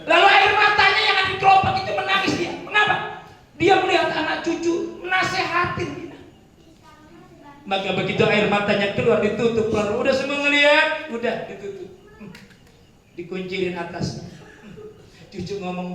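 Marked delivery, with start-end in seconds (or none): 0.0-11.9 khotbah berapi-api, tinggi dan keras; preaching. Acted. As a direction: berkhotbah penuh semangat. Preaching at full force. (khotbah lantang dan tinggi)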